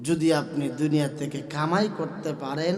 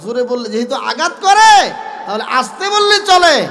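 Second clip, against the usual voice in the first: higher, louder exasperated rebuke; raised scolding; 8 semitones higher, 12 dB louder than usual. Acted. Abu Bakr scolding Bilal, loud and exasperated. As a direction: scold him to keep it down.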